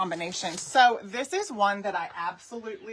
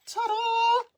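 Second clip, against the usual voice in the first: high bright ta-da, a little louder; excited reveal; 14 semitones higher, 4 dB louder than usual. Real, not acted. Crafter unveils her stencilled pup, high excited ta-da. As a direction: present it with a flourish.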